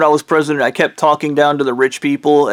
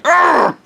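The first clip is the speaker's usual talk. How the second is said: a high, strangled growl of frustration; mock a man choking on his own anger; frustrated growl; 14 semitones higher than usual. Imitating Blondie, who has no comeback: high frustrated "argh". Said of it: "And he went".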